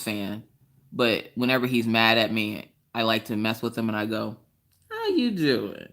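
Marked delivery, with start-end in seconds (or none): 4.9-5.9 high-pitched teasing catchphrase impression; celebrity catchphrase in a put-on high voice; Wendy Williams. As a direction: swoop high, then fall, to needle him. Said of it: "Williams voice 'cause he hates it".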